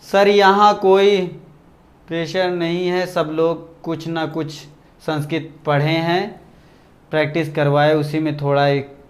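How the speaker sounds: low, very soft, halting read-out; reading a comment aloud. Retold or imitated. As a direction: read it slowly under your breath. Reads out a viewer's comment.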